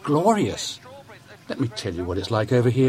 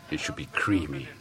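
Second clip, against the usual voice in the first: slow, soft, indulgent voiceover; luxury food ad voice; 8 semitones lower, 10 dB quieter, slower than usual. Acted. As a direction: purr it like a posh supermarket advert. Food ad parody.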